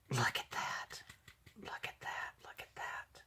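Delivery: fading, awed whisper; whispering in awe, fading away; hushed whisper. Real, not acted. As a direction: breathe it out in wonder. Awed by gift.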